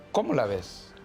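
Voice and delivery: normal talking voice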